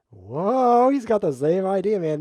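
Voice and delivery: loud, high surprised exclamation; loud high-pitched amazement; startled whoa. Real, not acted. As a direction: blurt it out in shock. Startled by opponent's move.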